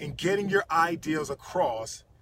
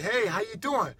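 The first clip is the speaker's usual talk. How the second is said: high, bouncy, energetic hello; upbeat greeting; 8 semitones higher, 3 dB louder than usual. Retold or imitated. Caller lifting up someone on the phone, enthusiastic. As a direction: greet them with infectious energy.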